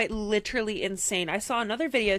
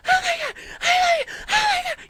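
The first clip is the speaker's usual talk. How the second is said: very high, loud shriek; shrieking fan impression; 7 dB louder than usual. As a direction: scream it like a panicked fan. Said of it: "just shrieked".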